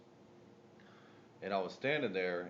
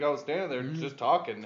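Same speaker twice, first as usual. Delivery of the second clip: ordinary talk, nothing marked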